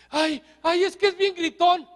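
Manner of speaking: high, loud, put-on whine; whiny complaining wife. Pastor mimics a wife complaining about her shouting husband in a high whine. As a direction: mimic a fed-up wife griping.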